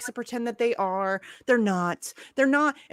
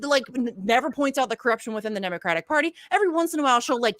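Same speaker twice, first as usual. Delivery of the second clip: normal talking voice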